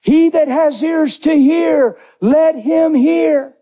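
high, drawn-out proclamation; crying out. Acted. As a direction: call it out slowly over a crowd. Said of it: "That's why he's crying out".

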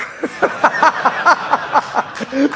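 high breathy rising laugh; joyful laugh. Real, not acted. Pastor celebrating the boy's new life, joyful laugh. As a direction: burst into happy laughter. Joy after baptism.

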